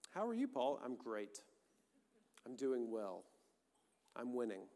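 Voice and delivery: mock small-talk question, then low, soft stoic answers; stoic self-assurance. Preacher acts out Paul answering how he is, mock-stoic. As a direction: understate each reply, quiet and composed. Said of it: "stoic self-assurance".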